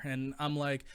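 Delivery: usual speaking voice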